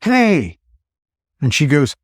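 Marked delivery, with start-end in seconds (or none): 0.0-0.6 high mock call at normal volume; retold yell. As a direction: imitate a distant shout without really yelling. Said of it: "they yell".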